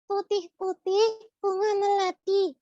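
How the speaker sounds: squeaky puppet, singsong verse; high puppet voice reciting a rhyme. Acted.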